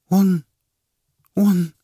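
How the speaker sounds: high, loud broken stammer; sobbing, words stumbling out; stammering through tears. Acted. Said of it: "Язык её заплетался". (жена запинается сквозь слёзы)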